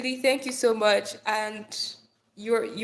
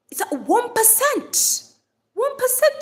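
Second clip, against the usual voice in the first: high, loud, slow shock at "one percent"; horrified at a dying phone; alarmed disbelief; 13 semitones higher, 6 dB louder than usual. Real, not acted. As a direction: squeal the news in dismay.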